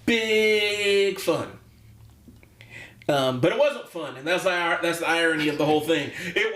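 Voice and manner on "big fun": high, drawn-out mock delight; sarcastic. Coach imitating a sitcom mom scolding her child, sarcastic. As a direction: stretch "Big" out, dripping with sarcasm. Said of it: "sarcastically saying".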